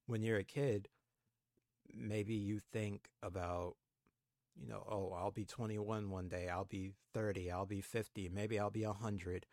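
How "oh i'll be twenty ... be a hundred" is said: ordinary delivery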